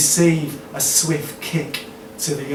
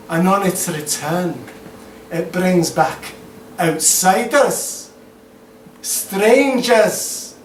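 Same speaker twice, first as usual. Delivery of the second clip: put-on Scottish accent; 5 semitones higher, 9 dB louder, slower than usual. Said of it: "do the Scottish accents".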